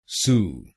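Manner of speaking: isolated syllable सु said clearly on its own; first syllable सु, crisply; syllable demo. Shown as a demonstration. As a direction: say सु slowly as a separate piece. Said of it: "एसयू को बोलिए सु".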